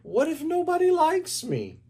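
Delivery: high, anxious monster voice; worried Grover. A narrator's reading. Grover asking his mother, high and worried. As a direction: let Grover fret up high. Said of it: "said Grover".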